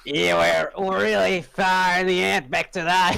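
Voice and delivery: put-on high, light Aussie accent; Australian accent. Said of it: "Stop doing the Australian accent".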